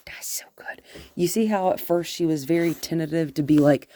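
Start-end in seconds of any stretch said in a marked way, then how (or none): 0.1-0.8 soft whispered aside. Real, not acted. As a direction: breathe it out in a whisper.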